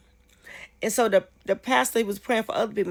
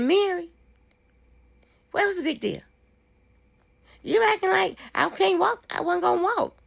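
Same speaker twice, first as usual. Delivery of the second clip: higher, quoting Sarah's bewildered reply; puzzled friend; 9 semitones higher than usual. Retold or imitated. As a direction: play the friend who doesn't see the fuss, higher.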